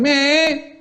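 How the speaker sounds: put-on high whining child voice; whiny kid. Whiny kid begging teacher to go out, high and whining. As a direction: whine like a bored child begging. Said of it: "a whiny kid going".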